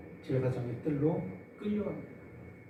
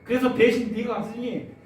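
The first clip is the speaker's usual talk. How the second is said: grieved declaration; 3 semitones higher, 7 dB louder than usual. Retold or imitated. God forsaking the son.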